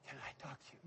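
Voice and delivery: breathy timid whisper; imitated whisper. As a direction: whisper it shyly like a nervous boy. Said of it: "That's how he spoke".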